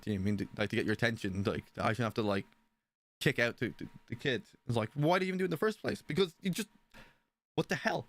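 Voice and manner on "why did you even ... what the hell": higher, fast, exasperated question; mild retold outburst at the wife; retold angry demand. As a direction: fire it back at her in disbelief.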